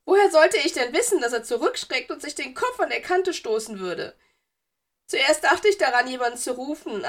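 put-on high, defensive, self-pitying ghost girl; wehleidiges Geistermädchen. A narrator's reading. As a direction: justify yourself in a high, plaintive voice. Narrator voices ghost girl. (hoch und wehleidig rechtfertigend)

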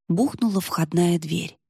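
plain reading voice

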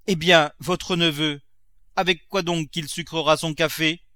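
challenging servant Nanon. Acted.

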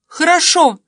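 high, very loud cry of agreement; frantic shout. A narrator's reading. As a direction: shout back at a high pitch. Frantic reply through blocked door.

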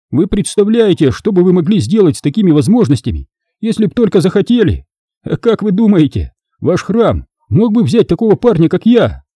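put-on high, eager young rogue; excited. A narrator's reading. Thief asking to join the temple, excited. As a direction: rattle on eagerly, pitched well up. Dan excited by Jedi powers.

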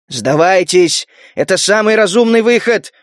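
ordinary delivery

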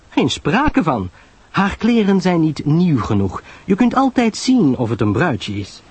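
high, animated know-it-all chatter; Pamela Lyle, knowing. Acted. Pamela tells Poirot about people-watching, knowing and enthusiastic. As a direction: hold forth in a high, lively voice.

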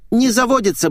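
put-on high-pitched girlish complaint; familiar little voice. A narrator's reading. Girl complains her car won't start, high little voice. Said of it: "тоже знакомый голосок".

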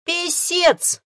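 high, loud fierce snap; menacing growl. Acted. Fox angrily corrects the boy. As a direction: bark the correction. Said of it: "грозно рыкнул".

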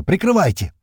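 high, urgent call; shouted order. A narrator's reading. Narrator needs cover while dragging wounded, shouting. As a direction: holler for cover.

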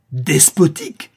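indignant outburst, high and loud; emportement. A narrator's reading. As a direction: throw the word back in outrage, drawn out. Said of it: "s'écria la comtesse avec emportement". (s'écrier avec emportement, indigné)

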